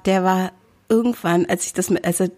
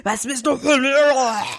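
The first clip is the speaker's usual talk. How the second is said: higher, odd-timbred monster roar; 6 semitones higher than usual. Retold or imitated. To the man on the train, a retold monster-voiced roar. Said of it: "Ich bin so mit meiner Monsterstimme".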